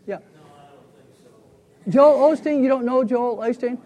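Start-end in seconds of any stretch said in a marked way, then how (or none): 1.9-3.8 loud, high disbelief at the name; can't believe they haven't heard of him; amazed teacher asking the class; surprised. Real, not acted. A listener doesn't know Osteen.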